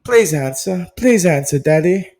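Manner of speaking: put-on high, pleading little girl on the phone. Girl on the phone begging her daddy, high and pleading. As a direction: beg Daddy to pick up, voice high. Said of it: "I don't know why she's British".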